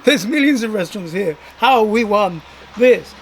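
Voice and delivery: happily stunned, asking if it's true; laughing disbelief. Retold or imitated. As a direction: laugh through your own shock at winning.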